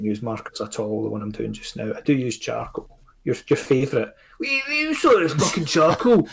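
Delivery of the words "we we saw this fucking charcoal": high, loud Cockney voice; Cockney accent. Retold or imitated. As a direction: ham up a London Cockney. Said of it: "that's my Cockney accent".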